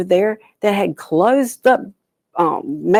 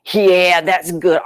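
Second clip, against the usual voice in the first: slow, louder, relishing agreement; gloating beaver; 6 dB louder, slower than usual. Acted. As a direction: savor the idea of a fight.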